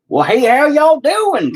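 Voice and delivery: hammed-up country drawl. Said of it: "these redneck, shit kicker".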